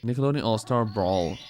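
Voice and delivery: usual speaking voice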